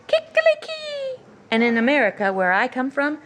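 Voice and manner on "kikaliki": much higher crowing kikaliki; Norwegian rooster crow. Shown as a demonstration. Teacher showing how Norwegian roosters crow. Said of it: "roosters say".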